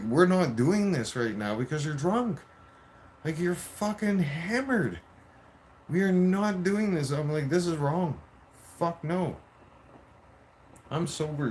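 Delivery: high-pitched, exasperated laying down of the law; insistent refusal. Retold or imitated. Retold argument with drunk partner.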